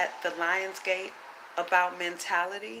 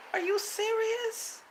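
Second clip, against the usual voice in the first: much higher, slow disbelief; incredulous; slower than usual. Real, not acted. Asking the viewers in slow, high disbelief. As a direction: drag it out like you can't believe it.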